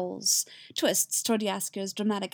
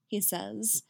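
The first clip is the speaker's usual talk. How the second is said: ordinary delivery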